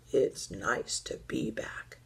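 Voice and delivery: put-on low old teddy voice; reply low, as an old bear. A narrator's reading.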